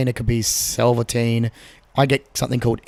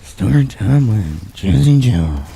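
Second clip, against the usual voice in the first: sultry Jazzy Joe; 2 semitones lower, 5 dB louder, slower than usual. Pretend. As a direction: croon the title like a late-night host. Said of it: "sultry voice".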